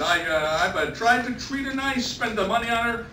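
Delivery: put-on storyteller's light take on an old Italian husband; lightly voiced Giuseppe. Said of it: "Giuseppe replied to the assembled husbands".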